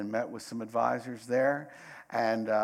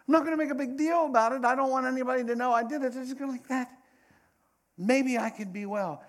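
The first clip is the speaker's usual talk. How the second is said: hurried secret hope; 12 semitones higher, 5 dB louder, faster than usual. Retold or imitated. Woman's inner thought voiced aloud.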